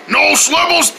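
talking through a mouthful; words round a mouthful of bacon; mouth full. Acted. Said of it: "My mouth is full of bacon".

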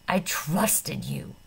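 slow, low, held-back fury; held-in rage. A narrator's reading. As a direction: grind the words out slowly, rage kept down. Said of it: "his body shaking with rage".

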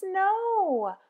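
ordinary delivery, nothing marked

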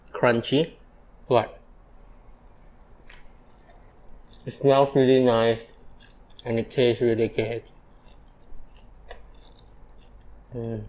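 chewing while talking; muffled food in mouth; mouth full. Real, not acted. Seen 0: Bites a glazed chicken piece; visibly chewing, mouth full throughout.

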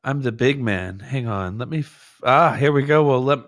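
usual speaking voice

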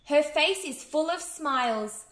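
plain reading voice

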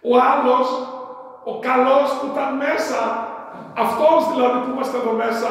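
high and loud accusation; pointed rebuke. Real, not acted. Preacher rebuking his own flock, high and loud. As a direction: point the finger at the congregation.

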